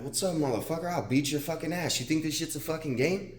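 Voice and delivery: imitating his past angry self; retold threat. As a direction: act out picking a fight. Retold picking a fight.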